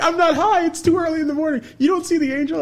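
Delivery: high, loud insistence; indignant protest. Retold or imitated. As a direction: defend yourself earnestly. Friend protests.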